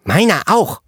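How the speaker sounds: put-on high child voice leaning on auch; child chiming in. A narrator's reading. Child echoing the girl, high voice, auch stressed. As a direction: chime in with the word auch stressed. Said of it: "hallte es aus der Zwergenfraktion".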